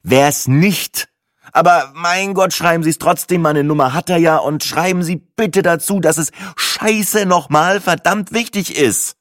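loud, high, exasperated ranting; fuming customer at the end of patience; angry outburst. Acted. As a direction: snap back and build to an emphatic curse. Swearing at the bank clerk.

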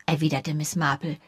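usual speaking voice

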